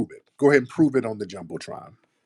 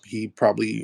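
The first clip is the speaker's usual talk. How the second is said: ordinary delivery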